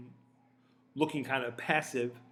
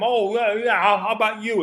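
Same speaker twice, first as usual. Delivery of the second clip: louder, taunting retort; mock snappy comeback; 4 semitones higher, 8 dB louder than usual. Guest firing back at the interviewer, taunting. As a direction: throw it right back at him. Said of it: "draw something back at him".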